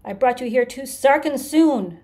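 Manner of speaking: high, very loud bellow; scream and bellow. A narrator's reading. Creature realizes its mistake, screaming and bellowing. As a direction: scream it out at full force. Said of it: "He started to scream and bellow".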